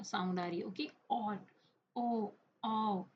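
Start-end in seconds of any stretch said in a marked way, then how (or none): 2.0-3.1 "आओ" drawn out slowly; ao-sound demo. Shown as a demonstration. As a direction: stretch the ao of "आओ" for learners. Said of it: "आओ के sound आ". ("आओ" को धीरे, खींचकर बोलना)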